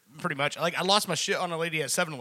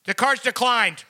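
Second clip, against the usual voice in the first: a bit louder, flat announcement; announcing loudly; 5 semitones higher, 6 dB louder, slower than usual. Retold or imitated. Cashier announcing the decline to the store, half-loud. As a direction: call it out to the store. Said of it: "like you're announcing it".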